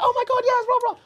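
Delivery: high squeaky mock girl voice; hammed-up excited girlfriend squeal. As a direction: mimic her squeal of delight, way up high. Voicing the girl's excited reply.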